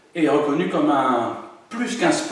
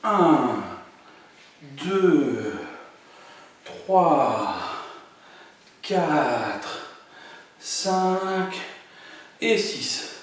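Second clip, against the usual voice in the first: slow, spaced-out count; 3 dB quieter, much slower than usual. Shown as a demonstration. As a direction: give each number time. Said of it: "on finit en refaisant doucement". (comptage lent et posé)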